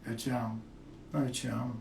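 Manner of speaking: soft, heartfelt I love you; sincere. Shown as a demonstration. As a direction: tell her you love her and mean it. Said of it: "Passa a verdade pra ela".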